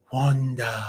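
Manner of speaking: low, soft, drawn-out name; quiet, deep imitation of an actor; Paul Bettany impression. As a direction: say it low and slow, hushed. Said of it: "Does the little Paul Bettany".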